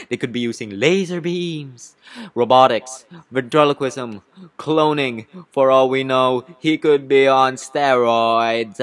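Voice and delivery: loud, theatrical accusations, drawn out at the end; hammed-up lawyer's outburst; accusatory courtroom tirade. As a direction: build a list of wild accusations, loudly. Lawyer accuses bee of trickery.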